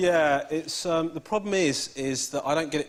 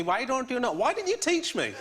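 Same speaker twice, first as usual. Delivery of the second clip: higher mock complaining mum with a Sri Lankan accent; mum's accent; 7 semitones higher than usual. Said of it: "And she goes to me".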